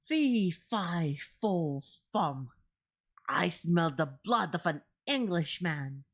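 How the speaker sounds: lightly voiced giant, mild mock bellow; a hint of a booming giant; understated storybook giant; giant's bellow. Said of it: "he bellowed".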